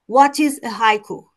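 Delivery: ordinary talk, nothing marked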